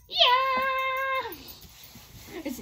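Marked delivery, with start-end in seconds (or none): 0.1-1.3 long high-pitched yell; mock-rebellious shriek; defiant playful scream. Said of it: "I'm gonna be screaming at home".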